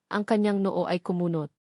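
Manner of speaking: usual speaking voice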